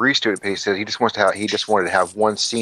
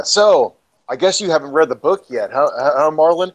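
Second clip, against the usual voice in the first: higher, taunting retold jibe; loaded needling; 6 semitones higher, 3 dB louder than usual. Loaded actor needles his co-star about the book, mock taunting. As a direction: imitate a tipsy man goading someone. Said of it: "kinda loaded or whatever".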